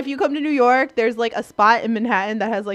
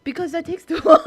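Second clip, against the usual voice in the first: high-pitched, said through laughter; laughing protest; 9 semitones higher, 5 dB louder than usual. Real, not acted. Woman excusing her texting shorthand, laughing protest. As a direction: defend yourself while cracking up. Defends abbreviation while laughing.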